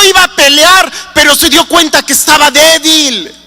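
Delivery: ordinary delivery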